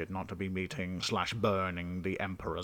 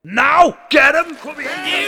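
shout; 20 dB louder than usual. Acted. King orders guards to seize.

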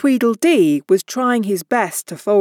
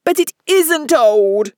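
higher, louder furious protest; furious cry; 6 semitones higher, 4 dB louder than usual. A narrator's reading. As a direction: hurl it back in rising fury. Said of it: "Tweedledum cried in a greater fury than ever".

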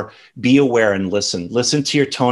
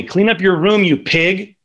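higher, hammed-up nagging mother; a mock scolding order from mom; imitating mom; 8 semitones higher than usual. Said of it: "My mom used to always tell me".

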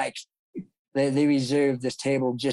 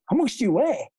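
imitating the trainer; 9 semitones higher than usual. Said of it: "That's how he said it".

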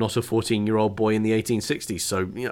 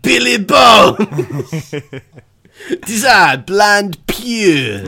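hammed-up French accent, higher and louder through laughter; mock French; 9 semitones higher, 8 dB louder, much slower than usual. Imagining the French Treasure Island scene, hammed-up French accent. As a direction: camp it up as a French pirate, laughing. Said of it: "French version of Treasure Island".

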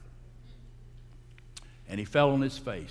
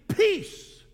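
high, loud cry of command; 5 dB louder than usual. Acted. Jesus speaking to the stormy sea, loud command. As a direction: call it out over the waves.

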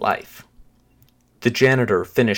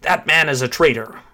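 raised, urgent shout of alarm; 6 semitones higher, 4 dB louder than usual. A narrator's reading. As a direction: call out an accusation, higher and forceful. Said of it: "ran into the hallway and shouted".

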